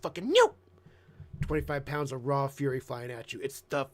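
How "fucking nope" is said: 1 excited burst. Real, not acted. Describing a charging bulldog.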